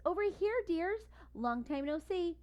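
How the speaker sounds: high sweet voice calling out; delighted old lady. A narrator's reading. Snickers welcomes friends in the flood, delighted. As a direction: gush over old friends.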